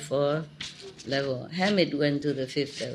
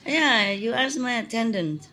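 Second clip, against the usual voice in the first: ordinary delivery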